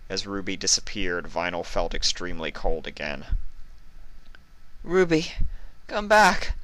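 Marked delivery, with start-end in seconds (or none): 4.8-6.5 fearful. Acted. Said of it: "a tone of fear evident".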